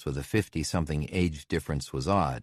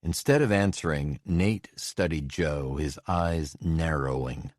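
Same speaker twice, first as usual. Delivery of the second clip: ordinary delivery, nothing marked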